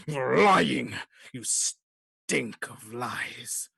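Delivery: loud growl sinking into a slow rasping hiss. Acted. Growling attacker accusing the brother, menacing growl. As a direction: growl the accusation, drawing out the last words. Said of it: "this loud growling voice".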